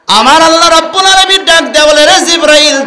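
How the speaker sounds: high, loud preaching cry; loud proclamation. Real, not acted. Preacher proclaiming God's call to Jibrail, loud. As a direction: proclaim it to the hall.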